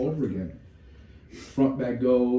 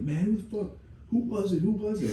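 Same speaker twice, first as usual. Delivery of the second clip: much higher, insistent repeated question; frustrated recruits; 10 semitones higher than usual. Retold or imitated. Recruits in the bay demanding who it was, frustrated high voice. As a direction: press them hard in a high strained voice.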